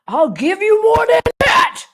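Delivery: high, loud, fierce yell from a protective mother; full mama bear shout. Retold or imitated. Movie mother defends her son, shouting in full mama bear mode. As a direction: belt it like a mama bear guarding her boy. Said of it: "She just turns into full mama bear".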